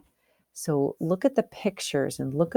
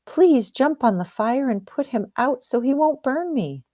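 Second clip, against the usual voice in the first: much higher, urgent begging; pleading rooster; 8 semitones higher than usual. Acted. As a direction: beg the water to save you. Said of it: "Then Half Chicken asked the water".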